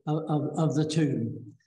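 usual speaking voice

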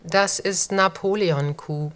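normal talking voice

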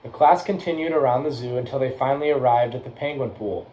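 ordinary delivery, nothing marked

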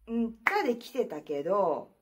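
ordinary talk

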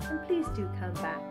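usual speaking voice

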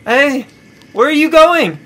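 high, loud alarmed shout; yelling. Acted. Abandoned Gavin yelling after Jasmine. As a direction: yell after someone leaving you behind. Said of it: "Gavin yelled".

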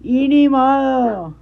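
high, loud, very slow "ni modo"; loud drawn-out shrug-off; defiant loud. Real, not acted. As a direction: belt it out, stubborn and final. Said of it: "voy a hablar como yo quiera".

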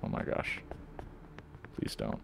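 breathless hushed whisper; barely voiced, hiding from her; whispering while hiding. Real, not acted. Hiding from monster, quiet.

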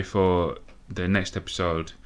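usual speaking voice

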